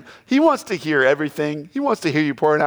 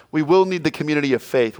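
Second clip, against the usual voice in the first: ordinary talk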